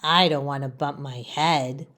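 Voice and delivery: low, soft fretful leaf; worried little leaf. Acted. Leaf tells friend Laurel his fears, low and soft. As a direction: fret quietly about falling. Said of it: "Leaf says to his friend Laurel".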